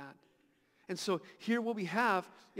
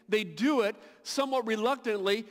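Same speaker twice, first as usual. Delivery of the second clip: ordinary delivery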